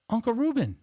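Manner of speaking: high imitation of a niece on the phone. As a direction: pitch it up as the niece. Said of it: "So it was my niece".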